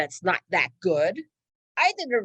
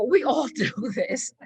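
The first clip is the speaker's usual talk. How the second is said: laughing; 4 semitones higher than usual. Real, not acted. Laughs through the line.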